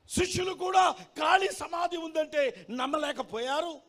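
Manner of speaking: high-pitched, forceful sermon delivery; impassioned preaching. Acted. Preacher telling congregation of the empty tomb, impassioned preaching.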